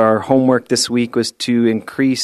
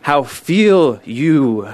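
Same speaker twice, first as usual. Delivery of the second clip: guru's line; 3 semitones higher, much slower than usual. Pretend. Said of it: "the guru's line is".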